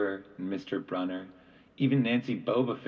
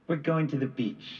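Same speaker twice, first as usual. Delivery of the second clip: mom voice; 6 semitones higher than usual. A narrator's reading.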